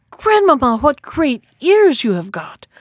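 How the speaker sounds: put-on high little-girl astonishment; greatly amazed. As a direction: exclaim in wonder, leaping up on the first word. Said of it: "being greatly amazed".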